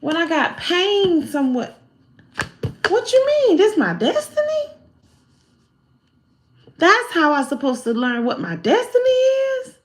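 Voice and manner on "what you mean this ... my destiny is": much higher, outraged questioning; indignant disbelief. Retold or imitated. Told pain teaches destiny, high indignant questions. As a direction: protest in a high voice.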